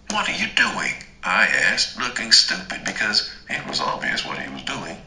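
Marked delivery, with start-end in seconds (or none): 0.1-1.0 sharp high startled question; surprised. A narrator's reading. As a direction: shoot up in pitch, caught off guard. Catching him in her wallet.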